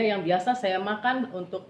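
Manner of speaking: ordinary talk, nothing marked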